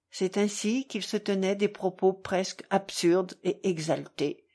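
ordinary delivery

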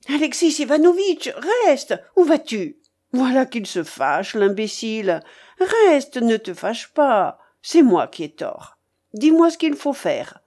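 high urgent call, then conceding; old gambler, relenting. Acted. Babouchka stops her departing companion, urgent then relenting. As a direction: call him back, grumble, then give in.

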